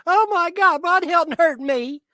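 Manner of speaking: mimicking trolls crying to police, high whiny mock; mock whining victim. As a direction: play the crybaby with a high, hammed-up whine. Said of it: "they'll whine and cry".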